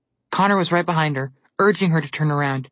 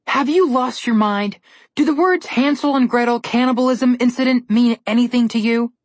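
exasperated; 8 semitones higher, 4 dB louder than usual. Acted. Brother scolding reckless sister.

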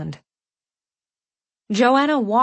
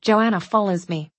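ordinary delivery, nothing marked

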